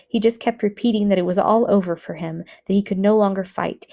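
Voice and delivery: ordinary delivery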